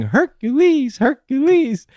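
high sung-out call; mother calling Hercules in a high voice; mom calling out. Retold or imitated. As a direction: call him like a proud mom. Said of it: "his mom starts saying".

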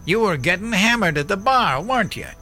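high, accusing yell; angry high yell. Acted. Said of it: "I spun him around and yelled".

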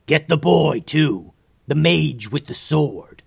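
loud villain ordering his minions; gritted-teeth call. A narrator's reading. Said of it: "Through gritted teeth, he called out".

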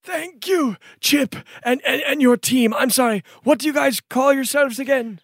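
high thankful noble cry; a relieved lord thanks the heroes; grateful nobleman. Acted. Noble thanking the party, high and grateful.

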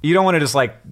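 ordinary talk, nothing marked

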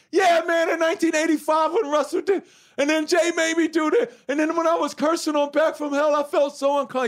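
high, loud, pouring it all out; heated outburst. Retold or imitated. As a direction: let it burst out high and loud. Blurting out his hidden resentment.

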